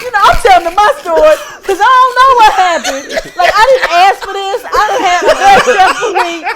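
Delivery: loud, high, laughing rant; hammed-up exasperated outburst through laughter; mock overwrought. Telling my story of what happened, mock overwrought.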